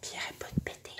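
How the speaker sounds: whispered aside to the camera. Real, not acted. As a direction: confide it in a whisper. Secret aside about the two guys.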